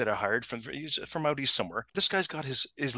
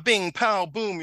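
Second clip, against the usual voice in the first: higher, louder spoken blow sounds; mock brawl sound effects, raised pitch; imitated fight noises; 6 semitones higher, 4 dB louder, much slower than usual. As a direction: punch out comic-book fight effects. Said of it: "what sounds like a fight".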